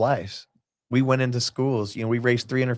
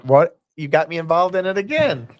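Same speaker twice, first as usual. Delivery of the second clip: high, loud exasperated what; mock disbelief; 7 semitones higher, 5 dB louder than usual. Host retelling being pulled into a team again, high and incredulous. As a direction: throw up your hands in disbelief.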